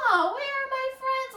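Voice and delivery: high, curious searching voice; curious caterpillar. Pretend. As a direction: peer around like a little caterpillar. Caterpillar looking for friends.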